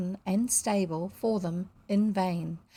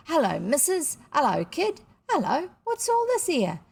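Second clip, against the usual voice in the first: higher-pitched, hearty working-class greeting; cheery, boisterous father; 7 semitones higher, 4 dB louder than usual. A narrator's reading. Father greeting his family on coming home, cheerily boisterous. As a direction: breeze in as a jolly cockney dad. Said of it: "He entered cheerily and boisterously".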